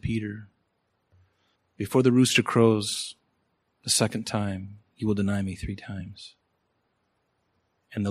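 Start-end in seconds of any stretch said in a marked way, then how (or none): 0.0-6.4 low, soft, grieving warning; Jesus, heartbroken. Retold or imitated. Preacher voicing Jesus warning Peter, heartbroken. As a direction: say it gently with a heavy heart. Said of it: "was his heart broken and said".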